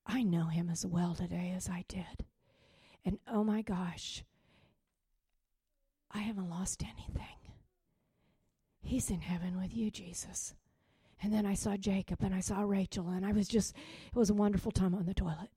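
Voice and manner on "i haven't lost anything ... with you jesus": soft, half-whispered prayer; hushed realization. Retold or imitated. Realizing in prayer to Jesus about David, hushed. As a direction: confide it softly, trailing into a whisper.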